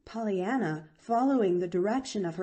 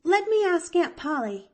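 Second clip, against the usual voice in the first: eager; 10 semitones higher, 6 dB louder than usual. A narrator's reading. Eager girl.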